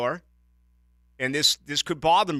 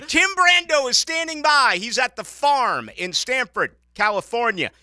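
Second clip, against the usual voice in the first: excited announcer; 8 semitones higher, 7 dB louder than usual. Real, not acted. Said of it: "radio promo for the next segment".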